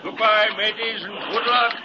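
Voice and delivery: high, carrying goodbye call; calling farewell. Acted. Captain sees off the rowboat, calling out high. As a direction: hail them as they row off.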